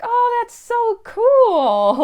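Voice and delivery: high, loud, drawn-out retold delight; delighted gushing. Replying to a lady's compliment, re-enacted delighted gushing. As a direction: gush it slowly, way up high.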